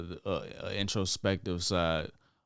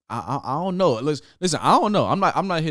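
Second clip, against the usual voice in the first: flustered; 7 semitones higher, 7 dB louder than usual. Real, not acted. Dodging the question, flustered.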